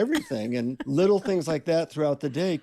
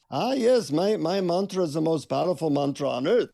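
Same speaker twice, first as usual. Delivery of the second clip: put-on lilting Indian-accented guru, pitched up at the start; 3 semitones higher than usual. Guru proudly presents his mantra to a visitor, Indian accent. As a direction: imitate an Indian guru's accent. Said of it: "in an Indian accent, he says".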